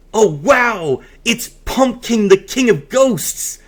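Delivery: hammed-up story surprise; mock amazement. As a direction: play wide-eyed surprise. Narrator acting amazed in story.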